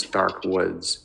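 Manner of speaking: plain reading voice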